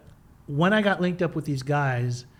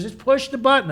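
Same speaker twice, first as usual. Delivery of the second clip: higher, insistent retold order; 9 semitones higher, 4 dB louder than usual. Kathy overruling his bad-idea objection, insistent. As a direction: imitate someone pressing the point.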